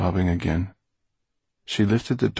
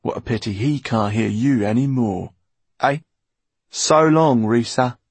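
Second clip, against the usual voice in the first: taunting villain's farewell, swelling loud at the end; gloat, then toss out a loud goodbye; mocking; 4 semitones higher, 5 dB louder than usual. A narrator's reading. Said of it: "Basta's mocking voice".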